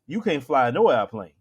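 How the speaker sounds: usual speaking voice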